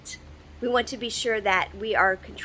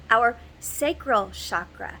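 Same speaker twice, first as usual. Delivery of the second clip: ordinary delivery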